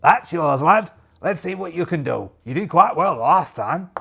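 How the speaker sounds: loud, hearty officer voice; captain's encouragement. Acted. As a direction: clap him on the back.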